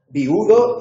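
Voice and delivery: VIUDO stretched loud as vi-U-do; three-syllable hiatus. Shown as a demonstration. Teacher asks class if it rings a bell, hiatus vi-U-do. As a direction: break "viudo" into three, hitting the u. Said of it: "son tres sílabas y viudo también".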